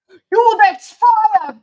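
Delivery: high, loud, very slow zoomer "Yo, that's fire"; mock zoomer slang. Parodying how zoomers talk, a loud slow high teen voice. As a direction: stretch it out like a cocky teen. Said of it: "do you speak that way?".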